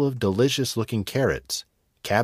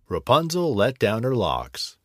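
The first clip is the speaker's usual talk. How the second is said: plain reading voice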